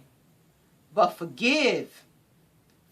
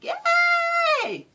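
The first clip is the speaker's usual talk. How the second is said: high, loud shout of joy; joyful mother cheer; 9 semitones higher, 7 dB louder, much slower than usual. Retold or imitated. Proud mother cheering for her children, joyful. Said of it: "she's like".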